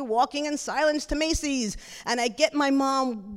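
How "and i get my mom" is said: usual speaking voice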